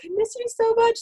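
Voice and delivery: high, teary mock sob; retelling herself weeping on the phone; imitating a sob. Recalling missing her parents, imitates a high teary voice. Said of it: "just, like, sob".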